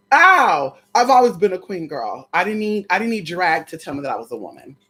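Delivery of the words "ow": loud pained yelp. Real, not acted. Follows shriek.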